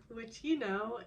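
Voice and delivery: ordinary talk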